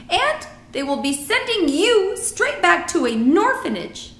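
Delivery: alarmed giant, high and loud; BFG. A narrator's reading. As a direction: warn emphatically as a friendly giant.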